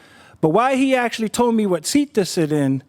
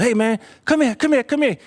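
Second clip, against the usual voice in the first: raised, higher voice calling someone across a platform; calling over; 5 semitones higher than usual. Retold or imitated. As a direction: beckon him over, pitched up. Conductor calls narrator over.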